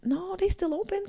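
high, soft disbelieving question, slowed; a slow, hushed, high double take; surprised. Retold or imitated. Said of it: "I was like".

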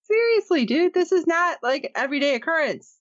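seriously, can you believe this; incredulous outburst. Real, not acted. Disbelief at the escape scene.

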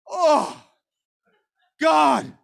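acted-out exasperated appeal; exasperated cry. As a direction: cry out in frustration. Exasperated cry at cash demand.

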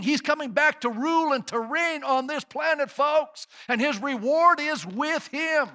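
fervent revival preacher; preaching at full force. Acted. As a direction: climb each line to the peak. Preaching crescendo about Jesus.

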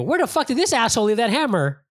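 high mock-baffled outburst; retold confusion. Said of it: "I go".

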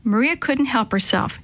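plain reading voice

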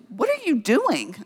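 mock bewilderment. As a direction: imitate a baffled friend demanding an explanation. Friend finds her stuck.

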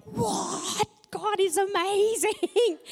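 high, thrilled cry of wonder, slowing on amazing; amazed outburst. Real, not acted. Preacher retelling her answered-prayer story, amazed and thrilled. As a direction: burst out in disbelief and joy.

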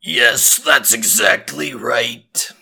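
deep, slow, growly robot voice; Samuel Hayden. Pretend. Son replies to mom in character, deep growly robot voice. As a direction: answer as an intimidating metallic robot. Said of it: "almost a metallic sound".

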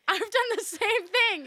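high, laughing confession. Real, not acted. Laughs while admitting it.

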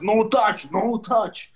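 put-on loud, high dad in Russian accent. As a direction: bark it like a stern Russian dad. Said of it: "with the heavy Russian accent".

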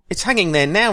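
put-on high, eager boy's voice. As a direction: chirp it up like a street boy. Boy character answers Scrooge.

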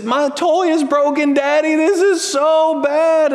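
high, slow whine to Daddy; whiny child. Retold or imitated. Daughter whining to her father about a broken toy. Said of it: "And she said".

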